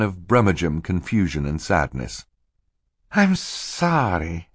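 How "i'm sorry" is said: slow, high, put-on apology; high, fluttery voice. A narrator's reading. Stefano feigns regret to Mister Poe, high and fluttery. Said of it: "in a high, fluttery voice".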